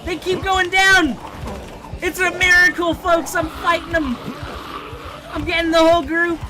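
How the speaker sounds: high, loud triumphant shouting; excited hype. Real, not acted. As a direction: cheer yourself on mid-fight. Hyped fighting streak.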